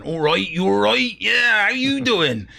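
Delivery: high, loud mocking West Country farmer voice; mock Bristol farmer accent. Londoners teasing a Bristol man, mock West Country farmer accent. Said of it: "start doing the farmer's accent".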